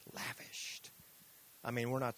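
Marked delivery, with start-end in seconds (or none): 0.1-0.9 whispered. Shown as a demonstration. Pastor savoring a Greek word, whispered. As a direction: whisper the word Lavished, drawn out softly. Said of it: "I love this Greek word".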